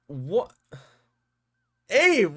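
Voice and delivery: usual speaking voice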